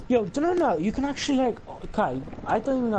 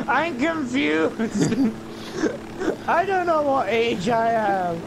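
higher and slow, dopey whine; mock confusion; 5 semitones higher, slower than usual. Acting a confused teen persona, in a slow, higher whine. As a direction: play it dim and drawn out.

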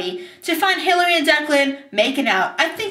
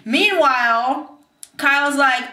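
ordinary talk, nothing marked

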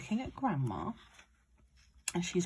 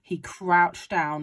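plain reading voice

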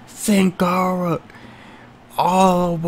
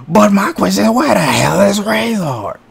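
usual speaking voice

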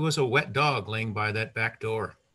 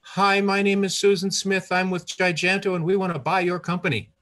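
rushed, higher and louder pitch; 6 semitones higher, 5 dB louder than usual. Retold or imitated. The caller rushing her buyout offer to Bud, hurried and loud. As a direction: blurt it all out in one eager breath. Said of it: "Now he heard in a rush".

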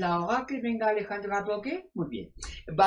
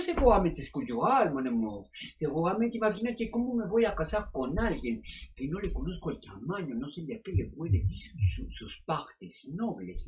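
sultry therapist. Acted. Sexy doctor answering callers on air, sultry and purring. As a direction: purr it like a seductive radio doctor.

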